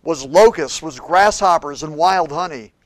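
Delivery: loud, high fervent preaching; preaching at full force. Acted. Pastor preaching on John the Baptist to the congregation, fervent. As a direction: preach it to the back row.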